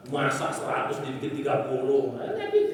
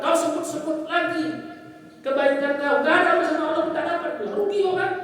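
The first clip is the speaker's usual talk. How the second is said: pitched high and louder, scolding; a preacher telling the mother off, pitched high and louder; rising rebuke; 12 semitones higher, 5 dB louder than usual. Retold or imitated. Preacher scolding a mother, pitched high and louder.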